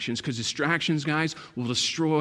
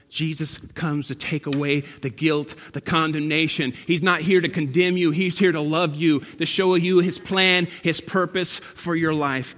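louder, rising preaching; full-force preaching; 3 semitones higher, 6 dB louder than usual. Acted. Pastor preaching hard to the church.

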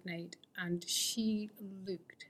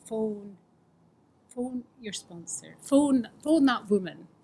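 inner voice; 2 semitones higher, 8 dB louder, slower than usual. Retold or imitated. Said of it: "came this voice that was like".

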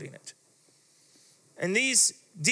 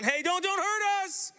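high, whiny begging demon; a frightened demon's high, pleading whine; 10 semitones higher than usual. Acted. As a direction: squeal like a cornered demon pleading for mercy. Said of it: "this demon starts going".

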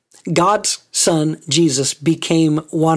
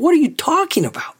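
high-pitched disbelieving question; baffled, indignant retort, pitched way up; incredulous; 11 semitones higher, faster than usual. Acted. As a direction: ask it as if you can't believe they said that. Voicing a bereaved child's disbelief.

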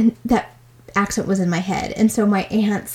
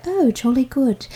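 put-on proper British English, slightly raised pitch; proper English accent; 4 semitones higher than usual. Shown as a demonstration. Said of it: "a little more proper English".

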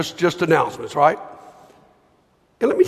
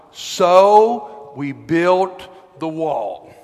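very slow, savoring each word; slow quiet emphasis; 3 semitones lower, much slower than usual. Shown as a demonstration. Preacher reading his favorite verse very slowly for effect. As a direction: let the small line land, slowly. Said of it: "my favorite line".

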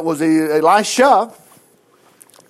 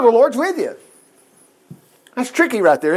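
ordinary talk, nothing marked

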